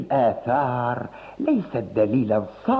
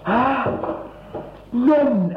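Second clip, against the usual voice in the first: surprised; 10 semitones higher, much slower than usual. Acted. Startled host finds guests.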